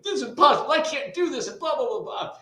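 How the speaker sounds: put-on high, whining teenage complaint; dad imitating his daughter's homework meltdown, high and whiny. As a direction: mimic a frustrated teen saying she can't. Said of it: "daughter comes up, like".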